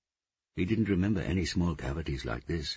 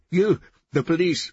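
high, loud shouted accusation; angry accusation; 11 dB louder than usual. A narrator's reading. Gonja blaming the policeman, shouting angrily. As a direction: fling it at them, shouting.

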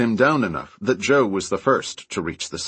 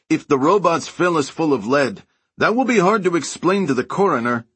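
raised voice, pitched up high; shouting; 7 semitones higher, 3 dB louder than usual. A narrator's reading. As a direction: shout it up to the roof. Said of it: "Joe shouted".

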